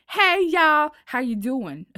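loud, high Southern call easing down; Southern twang, big on the opening; Southern-Northeastern accent. Shown as a demonstration. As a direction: demonstrate a hollered Southern-Northeastern greeting. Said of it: "mix of both Southern and, uh, Northeastern".

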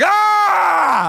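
high, very loud wail; mock dismayed scream. Pastor as rain suddenly returns, mock dismayed scream. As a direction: let out a hammed-up scream.

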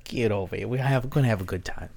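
soft mock-Minnesotan; quiet folksy Minnesotan invitation; wandering Minnesotan accent. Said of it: "where that accent's going".

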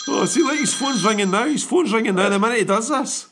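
high, loud, excited banter; gleeful, laughing hype. Real, not acted. Excited joking about phone ringing.

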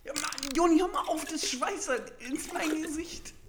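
high yelping protest while sprayed; sprayed with water. Real, not acted. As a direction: yelp as water hits the face. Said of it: "das spritzt mir alles ins Gesicht".

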